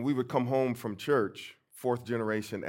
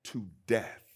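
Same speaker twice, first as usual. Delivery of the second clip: loud, raised, fervent urging; great-grandmother's charge; 6 semitones higher, 7 dB louder than usual. Retold or imitated. Great-grandmother charging the boy to learn his speeches, loud and impassioned. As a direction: preach her plea forcefully, dropping at the end. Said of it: "So she said".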